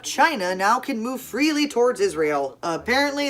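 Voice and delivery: usual speaking voice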